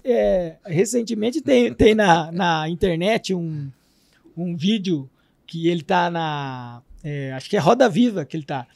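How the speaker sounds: ordinary talk, nothing marked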